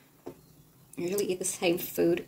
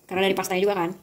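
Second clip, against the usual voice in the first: normal talking voice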